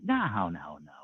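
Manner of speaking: ordinary talk, nothing marked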